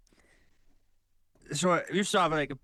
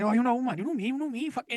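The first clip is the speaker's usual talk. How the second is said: lightly mimicked high-pitched old pal; pitched-up, pushy imitation of someone insisting you know them; over-familiar acquaintance; 10 semitones higher than usual. Acquaintance insisting he knows him, over-familiar.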